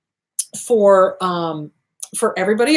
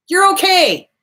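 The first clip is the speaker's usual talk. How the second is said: ordinary talk, nothing marked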